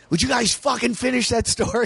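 high, impatient mock listener. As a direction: play a fed-up listener begging. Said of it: "listeners are going".

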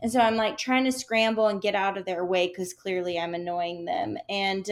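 ordinary talk

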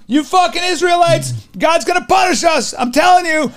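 high, shouted ranting of a wild-haired prophet; a street prophet yelling warnings with a cup; crazed prophet. Retold or imitated. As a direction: rail at the sinners like a doomsday prophet. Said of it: "banging on a cup going".

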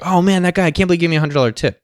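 gushing a little in disbelief; amazed tip recipient. Pretend. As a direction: act out a mildly amazed waiter. Imagined thrilled tip recipient.

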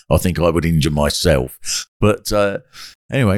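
normal talking voice

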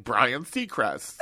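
comic mother voice, slow and stretched out; Italian mom. Acted. Mom getting a celebrity's name wrong, comic Italian mom. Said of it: "she'll be like".

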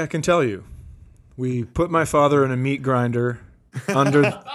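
ordinary talk, nothing marked

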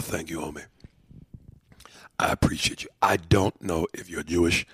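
breathy, voiceless thanks. Real, not acted. Host whispering thanks to a fan who wrote in.

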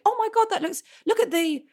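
high-pitched gasp of wonder, settling gradually; awestruck. Retold or imitated. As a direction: marvel at it like an impressed youngster. Child awed by fancy drink.

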